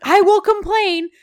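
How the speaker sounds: high, loud struggling demands; mock outraged protest. Woman being shoved toward the car, high mock outrage. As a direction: protest loudly as if being hauled away.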